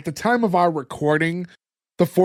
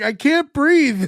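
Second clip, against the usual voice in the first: mock choking kid; 8 semitones higher, 4 dB louder, much slower than usual. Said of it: "the other kid's like".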